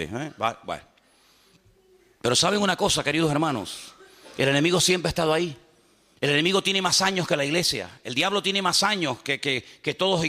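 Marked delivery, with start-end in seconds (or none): none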